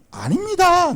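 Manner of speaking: high-pitched, insistent protest; acted urgent disclaimer; emphatic denial. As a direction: jump up in pitch to deny it firmly. Voices John denying he is Messiah.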